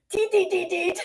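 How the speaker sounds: high-pitched tapping syllables; squeaky key-tap noises, close to laughing; mimicking keyboard typing. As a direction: imitate busy keys with a tiny high voice. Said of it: "on that keyboard".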